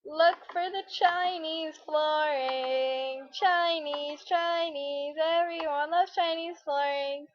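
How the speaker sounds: sing-song mock Chinese accent, drawn out. Touring the Chinese-style room in game, mock Chinese accent. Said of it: "Why do I sound so racist".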